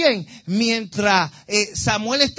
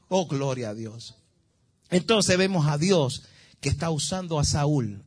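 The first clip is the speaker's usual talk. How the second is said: ordinary talk, nothing marked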